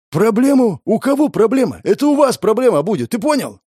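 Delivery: high, loud aggressive outburst; bully him, raising your voice; angry threatening thug. A narrator's reading. Thug threatening guard chief.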